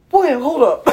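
half-laughing surprised hold up; mock startled girl. Retelling girl waking up, mock startled. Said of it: "she's like".

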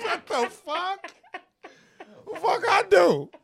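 high-pitched mock disbelief; squeaked-up, incredulous retelling; retold bafflement. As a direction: replay your bewildered protest through laughter. Retells his baffled reaction, laughing.

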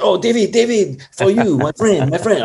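put-on French star hands the director a gift, high-pitched effusive French accent; high, gushing voice with French accent; effusive French actor. Jean gives David wine, imitated high French-accented effusiveness.

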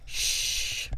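a breathy shushing sound; mock hush. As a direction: hush him like a secret. She hushes him while demonstrating.

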